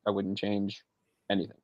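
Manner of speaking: "I wouldn't change anything" dropped soft, almost to himself. Shown as a demonstration. Echoing his own praise, trailing off softly. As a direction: let it fall away quietly. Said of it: "repeating for emphasis".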